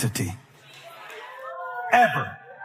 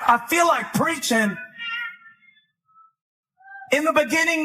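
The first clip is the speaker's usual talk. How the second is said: raised, rousing pulpit delivery; forceful preaching; 7 semitones higher than usual. Real, not acted. Preacher challenges believers with scripture, forceful preaching. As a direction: preach with building fervor.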